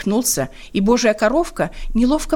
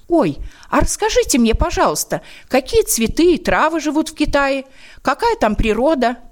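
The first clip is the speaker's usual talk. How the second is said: higher, eager little ladybug; curious; 7 semitones higher than usual. Acted. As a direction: ask with bright curiosity. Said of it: "Она заинтересовалась".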